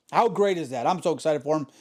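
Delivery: usual speaking voice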